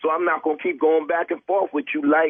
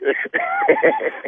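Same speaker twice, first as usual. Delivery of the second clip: squealing high laugh. Real, not acted. Laughing at the prank.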